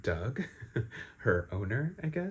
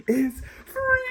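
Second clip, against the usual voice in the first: triumphant cheer; 6 dB louder than usual. Real, not acted. Celebrating heroine's escape.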